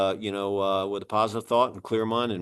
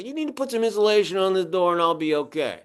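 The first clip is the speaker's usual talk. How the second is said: higher-pitched imitation of an old-timer inspector; good old boy; 6 semitones higher than usual. Inspector tells the homeowner about the attic ladder, good old boy imitation. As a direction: mimic a folksy veteran inspector, pitched up. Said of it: "Good old boy".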